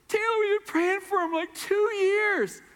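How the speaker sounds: put-on a girl telling the pastor how long she prayed; tearful girl. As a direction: draw it out through happy tears. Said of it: "sobbing uncontrollably".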